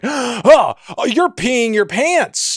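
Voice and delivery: high, gasping mock astonishment; gasp, then call it out high and drawn-out; mock shock. Reviewer acts out spotting Corey's wet pants, mock shock. Acting his startled reaction in a bit.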